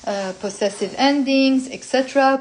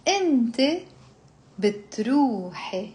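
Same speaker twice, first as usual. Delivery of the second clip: pronoun, long pause, then verb; slow conjugation drill. Shown as a demonstration. Teacher leading the feminine form for repeating, slowly. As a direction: separate the words for learners. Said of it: "you slowly build up".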